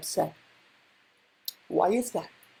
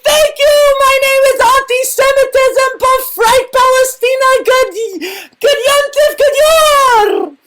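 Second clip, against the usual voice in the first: shouted sign-off; 11 semitones higher than usual. Acted. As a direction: yell the farewell over the applause. Loud comic sign-off after song.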